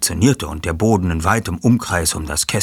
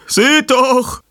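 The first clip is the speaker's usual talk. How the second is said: fassungslos; 8 dB louder than usual. Acted. Sanchez zeigt auf das Kästchen.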